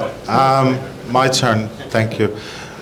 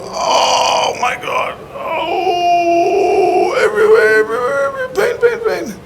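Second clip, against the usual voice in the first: very high, drawn-out mock cry of pain; hammed-up agonised wailing; slower than usual. Joke patient tells the doctor it hurts everywhere, hammed-up agonised wail. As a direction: wail it in a squeal far above your voice, hammed up.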